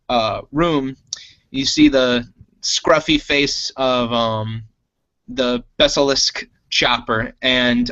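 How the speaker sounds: usual speaking voice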